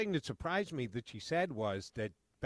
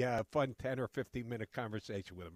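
ordinary talk, nothing marked

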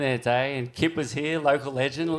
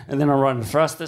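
usual speaking voice

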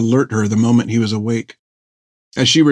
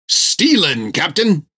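proud, bright, a bit higher; proud sailor voice; 6 semitones higher, slower than usual. Acted. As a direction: boast it proudly. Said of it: "face lit up".

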